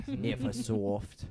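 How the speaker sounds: slow, low Aussie take on Neversoft; drawn-out Australian Neversoft; Australian accent. Pretend. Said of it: "These Australian accents are pretty good".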